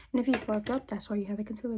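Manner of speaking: ordinary talk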